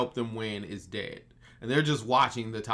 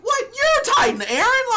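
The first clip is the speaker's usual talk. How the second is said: very high, loud, hammed-up outburst; over-the-top astonished cry; mock shock; 9 dB louder than usual. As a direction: squeal it in comic disbelief. Shocked reaction, played for laughs.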